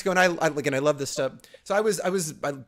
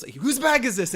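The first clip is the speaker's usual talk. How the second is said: stern demand; 8 semitones higher, slower than usual. Retold or imitated. Interrogating member demands.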